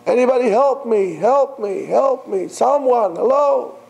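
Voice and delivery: high pleading cries for help; desperate call for help. Retold or imitated. Climber alone on the branch, desperate high calls. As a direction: beg anyone to hear. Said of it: "He starts crying".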